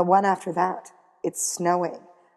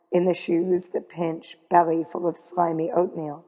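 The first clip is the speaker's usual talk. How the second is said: plain reading voice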